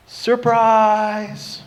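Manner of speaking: high, long drawn-out cheer; group surprise shout. Acted. Everyone jumps out at the birthday man, a drawn-out high cheer. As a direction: call it out and hold the last syllable. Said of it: "and they all go".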